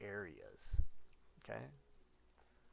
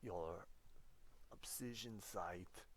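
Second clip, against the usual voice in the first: slow, lightly accented mock Arnold; Arnold Schwarzenegger; slower than usual. Teacher as Arnold drops a hasta la vista, slow and light. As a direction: drag out the catchphrase like a movie hero. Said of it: "I'm trying to do an Arnold Schwarzenegger impression".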